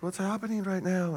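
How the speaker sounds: hushed, puzzled aside; baffled couple. Acted. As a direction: ask it softly under your breath. Bride and groom baffled.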